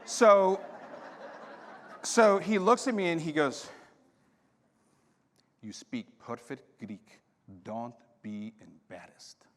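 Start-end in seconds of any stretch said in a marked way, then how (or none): 5.6-9.5 slow, hushed, low admonishment; imitating a priest's measured rebuke; quietly voiced priest. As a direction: drop soft and slow, spacing out each word. Priest's slow reply, acted out.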